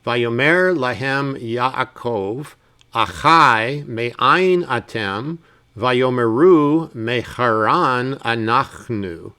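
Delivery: slow, each syllable drawn out for learners; very slow reading. Shown as a demonstration. Teacher reading the verse to students very slowly. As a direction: read it very slowly. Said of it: "very slowly and then at a normal speed".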